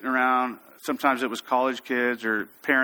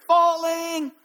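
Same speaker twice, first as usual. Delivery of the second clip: high, long shout of alarm; falling call; 14 semitones higher, 3 dB louder than usual. Shown as a demonstration. Climber warns the belayer of a fall, high call. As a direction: sing out the warning, high and drawn out. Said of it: "and then my favorite".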